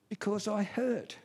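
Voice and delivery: put-on high, mildly plaintive child's answer; imitating hurt child. Crying son answering his dad, mildly plaintive. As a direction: play a hurt little boy explaining himself. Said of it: "he kept on crying".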